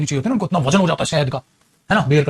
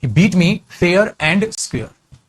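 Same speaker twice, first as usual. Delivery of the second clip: very slow, every word spaced out; slow dictation; much slower than usual. Shown as a demonstration. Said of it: "उसने बिना cheating किए मुझे हरा दिया था". (धीरे-धीरे, हर शब्द अलग बोलना)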